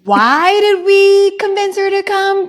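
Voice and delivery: high, slow wail with a long drawn-out WHY; mock exasperation. Imitating friends regretting they invited her along, exasperated.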